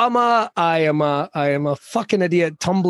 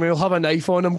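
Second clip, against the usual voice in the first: ordinary talk, nothing marked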